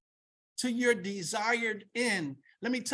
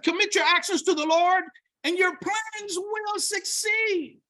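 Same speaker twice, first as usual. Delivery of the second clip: preaching at full force; 6 semitones higher, 4 dB louder than usual. Acted. Preacher reads verse with force.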